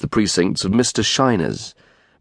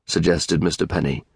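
plain reading voice

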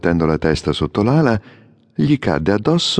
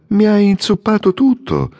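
much higher, indignant squeak; indignant outcry; 12 semitones higher, 3 dB louder than usual. A narrator's reading. Swallow scolds the statue for soaking him, indignant. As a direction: protest at being soaked.

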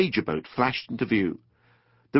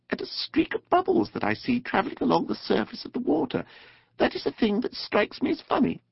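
high, small mole voice; mole's character voice; 13 semitones higher than usual. A narrator's reading. Mole answering his friend the rat, high little voice. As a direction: pitch it way up for a little animal.

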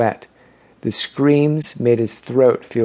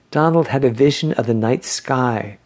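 usual speaking voice

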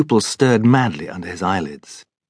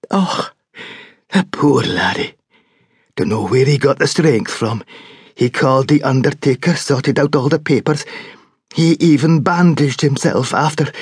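grieving Scots servant; 5 semitones higher than usual. A narrator's reading. Servant grieves for the young master, tearful and higher. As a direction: pity the poor laddie.

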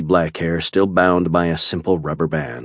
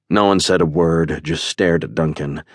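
ordinary delivery

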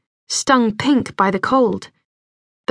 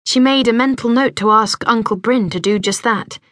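ordinary delivery, nothing marked

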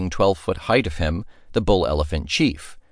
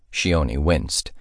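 ordinary delivery

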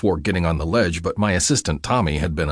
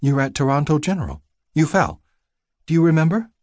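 female nurse voice; 8 semitones higher than usual. Acted. Nurse voice in narrated scene.

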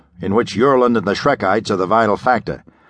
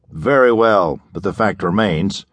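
plain reading voice

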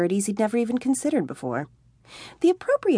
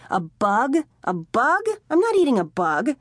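rising, louder, appalled; outraged disbelief; 6 semitones higher, 3 dB louder than usual. A narrator's reading. Tommy refuses Jody's offer of a bug, rising outrage.

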